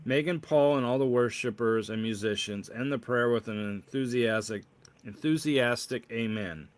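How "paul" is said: usual speaking voice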